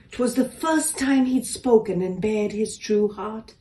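ordinary delivery, nothing marked

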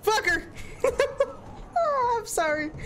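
laughing through the words. Real, not acted. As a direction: crack up while apologizing. Streamer laughs at abandoning Sarah.

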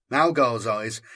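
ordinary delivery, nothing marked

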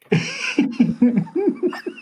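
a high, bright burst of laughter; real amused laugh. Real, not acted. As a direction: laugh at your own punchline. Laughing at his own reply.

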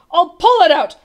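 high, loud yell. A narrator's reading. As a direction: shout a determined announcement. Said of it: "he yelled".

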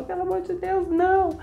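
high, soft begging; imitated victim's high plea; frightened plea. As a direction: plead for your life in a small voice. Victim pleading before being killed.

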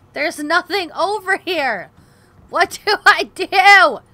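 slow, loud and high desperate yell; loud drawn-out helpless cry; exasperated shout. Real, not acted. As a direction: wail it out in despair. Stuck in game, desperate.

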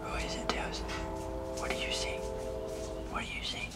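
hushed whisper to the dog. Real, not acted. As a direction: whisper softly to her.

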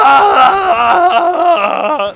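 long, loud high-pitched scream; put-on scream. Caster screams for a flamethrower kill, put-on scream. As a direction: scream at full volume for effect. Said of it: "told you I'd scream".

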